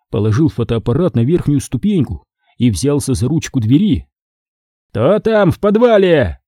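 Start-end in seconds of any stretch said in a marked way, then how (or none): 4.9-6.4 high-pitched angry demand; angry booming. A narrator's reading. Spider challenging the intruders in his cellar, angry. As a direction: demand it angrily, voice raised high. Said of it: "Злой голос паука гремел".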